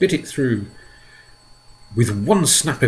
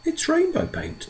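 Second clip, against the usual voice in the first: James answering; 4 dB quieter than usual. A narrator's reading. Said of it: "James answered".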